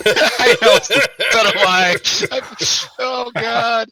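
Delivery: high, breathless laughter through the words; can barely get it out for laughing; laughing hard. Real, not acted. Player cracking up at the kobold password gag, laughing loudly.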